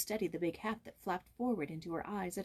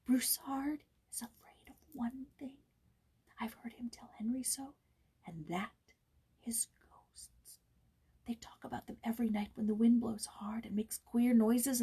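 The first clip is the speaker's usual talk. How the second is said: hushed secret whisper; boy confiding a fearful secret, whispering; 4 semitones higher than usual. A narrator's reading. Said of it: "his voice sank to a whisper".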